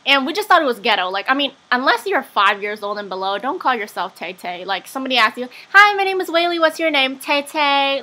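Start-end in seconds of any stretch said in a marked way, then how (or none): 5.7-8.0 high-pitched mocking role-play; mock introduction. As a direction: act out a silly introduction up high. Mocking a girl's childish self-introduction.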